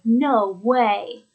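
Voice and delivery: loud, drawn-out surprise; amazed exclamation, leaning on both words; astonished. Shown as a demonstration. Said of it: "intonation of surprise or expressing surprise".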